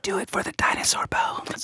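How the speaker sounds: hushed, sneaky whisper to the judge; whispered bribe. Real, not acted. Debater secretly urging the judge, whispering. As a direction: slip the judge a secret plea.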